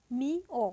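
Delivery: মি ও, two separate syllables with a gap. Shown as a demonstration. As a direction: split মি ও slowly like a teacher. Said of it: "উচ্চারিত হচ্ছে".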